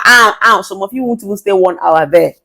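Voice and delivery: ordinary delivery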